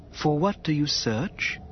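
slow wary question; stately alien official. Acted. Maiden asks.

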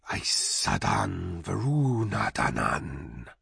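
low, slow, vast hissing dragon; growling dragon guarding treasure. Acted. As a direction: rumble it out like an ancient wyrm. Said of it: "said the vast hissing voice".

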